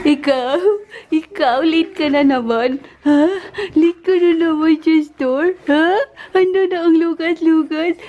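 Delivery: high, cooing questions; playful mock interrogation. Asking the little one about being late, playful mock interrogation. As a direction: tease with high-pitched, drawn questioning.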